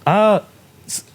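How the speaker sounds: ordinary talk, nothing marked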